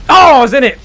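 sudden loud yelp of disbelief; loud outcry. Real, not acted. Yelling at a friend who just shot him down, loud. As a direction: blurt it out loud, caught off guard. Shot down mid-flight in game.

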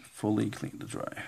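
ordinary talk

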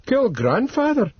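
shocked disbelief. A narrator's reading. Lockhart shocked question.

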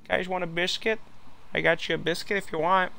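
high, polite put-on voice; eager-to-please neighbour. Neighbour offering the guys a biscuit, high polite voice.

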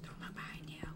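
spooky whisper; creepy hushed warning. Pretend. Teasing the chased player.

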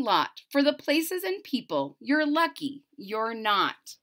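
lively storybook read-aloud; bouncy picture-book reading voice; reading to children. Acted. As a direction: bring the rhymes to life for little listeners.

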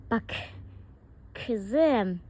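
normal talking voice

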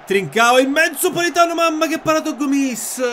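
high, loud burst of commentary; excited commentary. Real, not acted. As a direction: yell the save like live TV. Live match commentary. (telecronaca esaltata)

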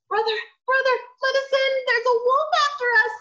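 high, panicked pig voices; frightened pigs. Acted. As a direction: cry for help, high and fast. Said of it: "they said".

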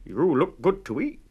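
a hungry cow eyeing a snack; loud hungry cow. A narrator's reading. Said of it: "mooed the cow".

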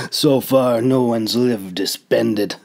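plain reading voice

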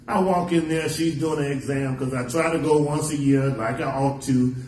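normal talking voice

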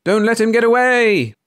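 high, loud shout to the crowd; alarmed yell. A narrator's reading. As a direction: yell to stop him escaping. Crowd yells as he flees.

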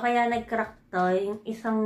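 speaking around a finger; finger in mouth. Real, not acted. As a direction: lip held down by finger. Seen 1: finger pulls lower lip over teeth, then drops at 1.1.

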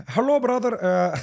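high, loud mock Indian accent; Indian scammer accent. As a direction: greet the mark like a pushy phone scammer, high and loud. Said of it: "you're gonna be an Indian scammer".

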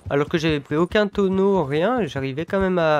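usual speaking voice

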